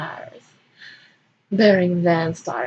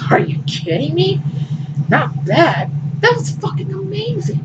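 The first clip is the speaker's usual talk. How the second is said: higher, excited disbelief; excited Undoom; 11 semitones higher than usual. Acted. Undoom answers Bering's "not bad", higher and excited. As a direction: gush with disbelief. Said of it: "try and fail to imitate".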